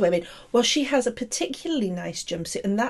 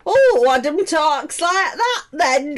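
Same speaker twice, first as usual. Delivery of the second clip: slow, higher mock Leeds accent; 4 semitones higher, 4 dB louder than usual. Mimicking their co-host from up north, mock Leeds accent. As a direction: exaggerate a Leeds accent, drawing it out. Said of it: "like, Leeds way".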